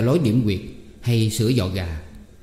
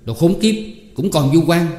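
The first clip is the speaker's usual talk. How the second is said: angry cursing; 6 semitones higher, 4 dB louder than usual. Acted. Hai Quá cursing the accused.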